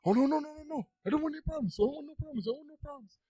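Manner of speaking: mock panic, very high and rapid; scared store owner. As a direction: imitate a shopkeeper suddenly backing down in fright. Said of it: "he sees my big ass dad".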